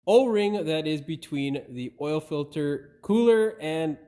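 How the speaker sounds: usual speaking voice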